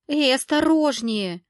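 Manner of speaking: slow, loud indignant snap. A narrator's reading. Valeria shoved by someone, loud and indignant. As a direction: bristle at the shove. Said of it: "возмутилась Валерия".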